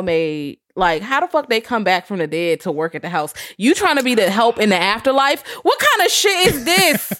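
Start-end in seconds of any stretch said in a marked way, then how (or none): 5.6-7.2 mock outrage. Mocking the ghosts' choice.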